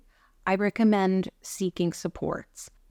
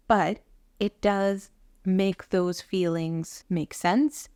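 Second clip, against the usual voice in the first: usual speaking voice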